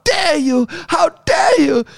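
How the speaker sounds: slow, high-pitched outraged accusation; hammed-up righteous indignation; Greta Thunberg. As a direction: imitate an indignant activist scolding a crowd. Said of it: "like, a bit like a Greta Thunberg".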